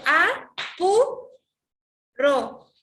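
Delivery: "a, pu, ro" in loud separate syllables, "pu" swept up high; stress on PU. Shown as a demonstration. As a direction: sound out "a, pu, ro", pitch climbing on "pu", dropping on "ro". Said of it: "cuando digo pu estoy subiendo la voz".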